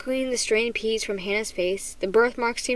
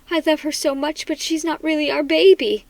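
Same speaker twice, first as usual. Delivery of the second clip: higher-pitched, upset confession; anguished mother, voice raised in pitch; tearful; 4 semitones higher, 5 dB louder than usual. A narrator's reading. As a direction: plead it through mild distress.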